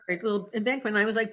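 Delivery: ordinary talk, nothing marked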